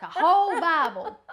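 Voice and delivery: high, loud, laughing finish; mock incredulous exclamation. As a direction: blurt it in amused mock disbelief. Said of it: "and he said".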